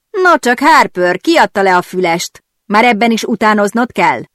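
sharp, snide comeback, opening high and loud; biting retort. A narrator's reading. Patrick snapping back at his rival Harper, biting. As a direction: jab back with acid in the voice. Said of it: "támadott vissza epésen Patrick".

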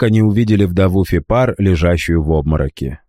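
usual speaking voice

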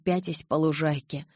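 plain reading voice

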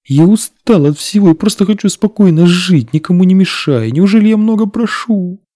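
high, loud broken plea; desperate pleading. A narrator's reading. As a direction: cry out in exhausted despair. Humiliated man crawling pleads. (отчаянная мольба)

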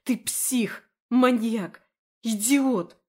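put-on girl screaming insults at her captor; furious insults. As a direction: hurl every insult at him. She hurls insults at him.

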